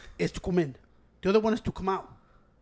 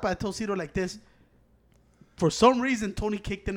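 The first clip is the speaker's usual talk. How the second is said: ordinary talk, nothing marked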